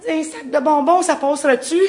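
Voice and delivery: put-on high, loud, hopeful little kid's voice; hopeful kid offer. Kid offering the bully a bag of candy, high and eager.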